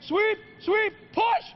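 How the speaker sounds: high excited shouting; shouting at sweepers. Pretend. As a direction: yell at the sweepers to go. Host pretends to skip the shot.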